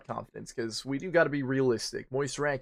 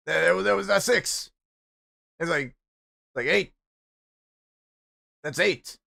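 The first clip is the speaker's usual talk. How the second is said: higher, louder indignant complaint; mock outraged girlfriend; 4 semitones higher, 3 dB louder than usual. Girlfriend scolding him over the measurement in mock outrage. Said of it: "And she's like".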